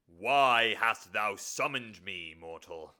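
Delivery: loud, slow, drawn-out demon bellow; summoned demon. Acted. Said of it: "This will be the demon".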